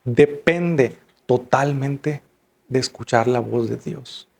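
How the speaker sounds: drawn-out, deliberate preaching emphasis; slow it right down so every word lands; slow emphatic. Acted. Preacher to the congregation, slow and weighty. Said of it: "escuche bien".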